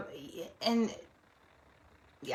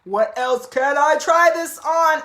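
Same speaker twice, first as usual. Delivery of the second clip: eager excitement; 7 semitones higher, 12 dB louder, slower than usual. Retold or imitated. Eager self quoted, hooked on tapping.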